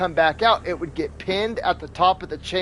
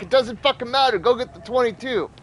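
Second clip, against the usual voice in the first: raised-pitch, impatient insistence; exasperated retold order; 6 semitones higher than usual. Husband overruling wife's question about the gun, exasperated. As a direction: cut off the question, insist urgently. Acted argument with wife.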